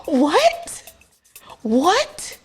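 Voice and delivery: high, sharply rising disbelief; squeaky baffled questions with gaps between; incredulous. Acted. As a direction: repeat it as if unable to believe it. Repeated disbelief, comic.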